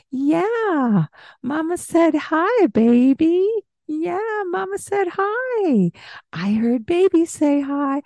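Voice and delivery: put-on high sing-song cooing at a baby; baby talk. Shown as a demonstration. Mother cooing back to her infant, high baby talk. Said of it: "So there you can hear ... baby talk".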